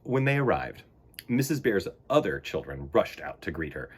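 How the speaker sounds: ordinary delivery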